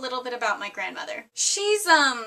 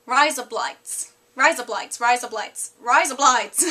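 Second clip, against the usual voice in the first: practised Australian accent, repeating the phrase; 4 dB louder, slower than usual. Shown as a demonstration. Vlogger shows viewers the razor blades trick in Australian accent. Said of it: "with an Australian accent".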